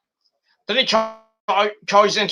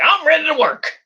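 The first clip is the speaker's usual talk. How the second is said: higher, eager butler voice; eager mock butler; 10 semitones higher than usual. Cameron the happy butler reporting for duty, higher. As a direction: sound keen to serve, pitched up. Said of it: "He's like".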